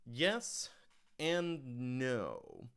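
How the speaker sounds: usual speaking voice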